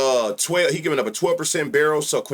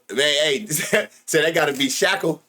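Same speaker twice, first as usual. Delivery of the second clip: usual speaking voice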